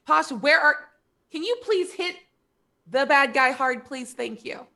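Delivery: high, loud plea broken by pauses; acted calling out. Bard yelling over to Pasha for help, acted calling out. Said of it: "so I will, uh, yell".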